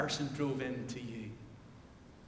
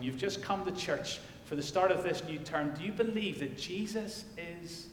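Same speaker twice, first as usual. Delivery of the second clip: normal talking voice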